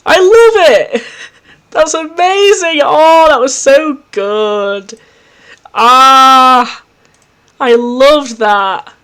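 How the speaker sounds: thrilled by the writing; raving. Real, not acted. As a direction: savour every word of praise. Raving after the episode.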